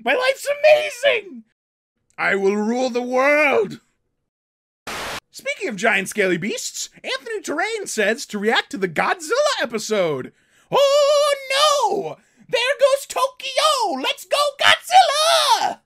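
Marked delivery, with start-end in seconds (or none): 0.0-1.4 high, loud gleeful cheer; gleeful gloat. Real, not acted. Celebrating his dragon and dinosaur, gleeful gloat. 10.7-15.8 high, loud, slow hype; hyped cheer. Real, not acted. Cheering on Godzilla at Tokyo, hyped chant.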